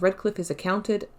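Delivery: ordinary delivery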